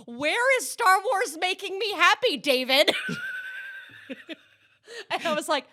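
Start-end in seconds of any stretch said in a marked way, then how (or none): 0.1-2.9 high, loud, pleading outrage; mock indignant wail. Friend berating Dave Filoni over the show, indignant wail. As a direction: imitate a fan scolding the creator from afar.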